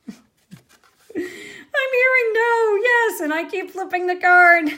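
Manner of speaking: words breaking into real laughter; laughing through words. Real, not acted. Host laughing at the viewers' flip-flopping votes, laughing.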